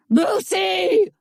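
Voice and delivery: distant voice shouting her name; crying out. A narrator's reading. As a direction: cry out from the shadows. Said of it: "the voice cried out again".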